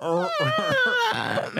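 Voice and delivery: high, terrified whimpering sounds; mock frightened whimper. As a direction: whimper like a cornered victim. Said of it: "like you did before".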